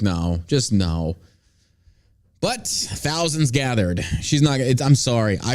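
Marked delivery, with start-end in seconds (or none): 0.0-1.2 low, slow mock dismissive; drawn-out mock refusal. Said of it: "this woman is just like".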